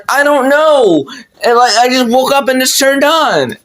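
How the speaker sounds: high-pitched plea of innocence; defensive protest. Retold or imitated. Kid explaining the TV to mom, defensive. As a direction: imitate a flustered kid making excuses.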